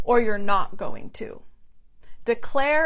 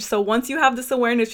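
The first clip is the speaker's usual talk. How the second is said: usual speaking voice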